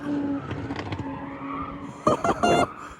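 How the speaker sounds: high breathy laugh of disbelief; amazed laugh. Real, not acted. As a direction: laugh in stunned delight. Laughs at spun-out car.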